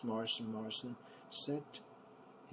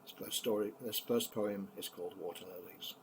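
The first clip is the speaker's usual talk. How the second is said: plain reading voice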